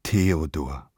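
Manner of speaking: ordinary talk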